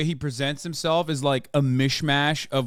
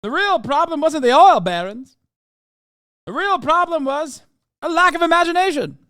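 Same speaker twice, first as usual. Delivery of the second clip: put-on high, loud transatlantic accent; hammy old-Hollywood transatlantic accent; 14 semitones higher, 7 dB louder than usual. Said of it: "transatlantic accent".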